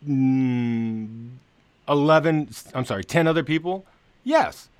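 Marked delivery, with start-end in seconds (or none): 4.2-4.7 squeaky emphatic yes. Real, not acted. Answering own rhetorical questions.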